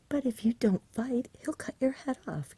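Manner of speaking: a touch of breathless fear; gasped. Acted. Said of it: "gasped the boy".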